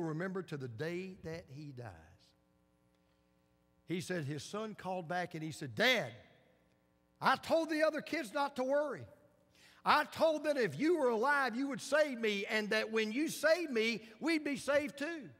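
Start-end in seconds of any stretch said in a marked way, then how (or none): 5.7-15.3 put-on higher and louder, a boy's earnest voice; child's voice. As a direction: speak up high like a trusting little boy. Son telling father his faith.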